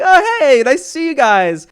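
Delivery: high bright greeting, then settles; breezy fake-innocent hi to friends; mock casual hello. Sliding into line, greeting friends with a high, cheery hello.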